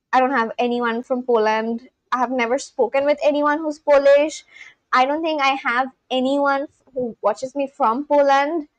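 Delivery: ordinary talk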